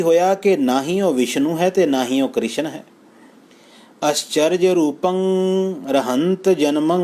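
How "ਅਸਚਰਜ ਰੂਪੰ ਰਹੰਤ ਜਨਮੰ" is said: slightly slowed, lifted recitation; recited scripture. Acted. Preacher quoting the verse to listeners, slightly slowed. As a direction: lift and stretch the verse a little.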